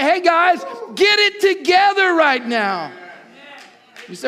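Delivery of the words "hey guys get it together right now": a hint of a stern call, higher; lightly voiced God scolding. God telling the church to get it together, mild scolding. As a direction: call the church to order, mildly. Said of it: "And God is saying".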